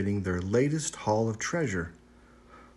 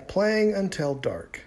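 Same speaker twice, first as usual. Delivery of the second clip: plain reading voice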